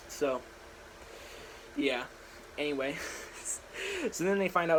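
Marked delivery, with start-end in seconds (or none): 3.0-4.1 airy high-pitched giggle; high breathless giggle. Real, not acted. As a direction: let out a squeaky silent laugh. Laughing at himself.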